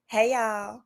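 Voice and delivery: breezy come-on greeting after clearing the throat; pickup line greeting. Shown as a demonstration. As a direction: toss it out like a flirty opener. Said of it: "my international pickup line is as follows".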